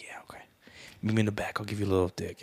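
secretive whisper. Retold or imitated. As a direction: whisper it like a shady offer. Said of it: "I was like".

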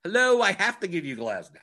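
high, loud "Hello, hello" as if rapping on a head; playfully exasperated optimizer, hammed up; mock exasperated call. The optimizer scolding the player, loud and exasperated. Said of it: "it's going".

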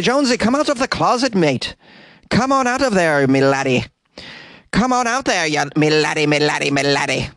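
high-pitched, hammed-up Irish callers; butchered Irish accent. People urging Jonesy out of the closet, butchered Irish accent. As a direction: coax in a silly, high mock-Irish voice. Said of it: "I'm the king of butchering accents".

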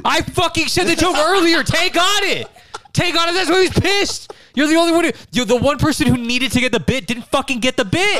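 high-pitched, indignant comic rant; exasperated yelling, pitch way up; heated comic outrage. Real, not acted. Berating co-host who missed the bit, high comic outrage.

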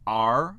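English R, loud and drawn out, "ar"; R as English "ar", loud, rising; front-of-mouth R. Shown as a demonstration. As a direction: say R forward in the mouth, not in the throat. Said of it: "c'est dans l'avant de la bouche".

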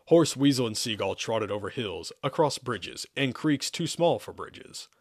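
ordinary delivery

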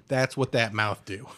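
ordinary talk, nothing marked

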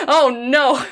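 high, loud laugh through words. Real, not acted. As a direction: laugh it out, delighted. Laughing at overexcited co-host.

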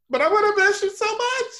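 high-pitched mock wail; hammed-up lovesick crying; tearful goodbye. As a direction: burst out weepy and high.

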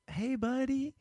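high, soft mock sweetness; mock cooing. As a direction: coo it up high to mock the gentle greeting. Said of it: "you went from".

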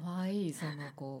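soft, low murmured repeat; quiet. Real, not acted. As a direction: say it softly under your breath. Repeats it to herself softly.

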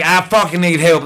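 high, desperate demand; retold plea at full tilt; loud outburst. Newcomer begging the meeting for help, loud and worked up. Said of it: "I may end up getting loud".

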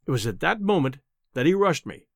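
plain reading voice

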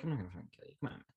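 soft, offhand mock reassurance; deflating dismissal. Villain waving off his friend's fear, retold as soft dismissal. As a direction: brush the threat off quietly, as the character. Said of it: "he immediately deflates".

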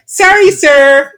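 high-pitched, pleading mock apology; subservient. Retelling apologizing to an aggressive man for lateness, subservient. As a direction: grovel in a high, meek voice. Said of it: "I just was totally subservient".

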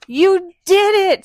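loud, high, slow celebration; character celebrating success; triumphant cheer. Acted. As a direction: cheer the win. Triumphant story line.

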